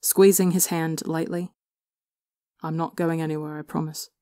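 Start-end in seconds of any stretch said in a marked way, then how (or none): 2.6-4.1 much softer, gentle; soft promise. A narrator's reading. Brother quietly promises Tommy he stays, soft and gentle.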